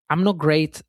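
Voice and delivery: normal talking voice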